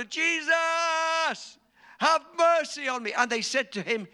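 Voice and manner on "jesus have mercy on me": even higher and louder desperate cry; shouting louder. Retold or imitated. Blind man shouting louder after Jesus walks on, in a desperate yell. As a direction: yell it over the crowd, begging. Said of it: "So he shouted louder".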